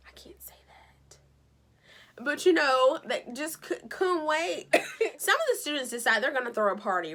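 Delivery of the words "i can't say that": breathy whisper, taking it back; whispered aside. Real, not acted. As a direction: whisper it like you shouldn't have said it. Catching herself after cursing.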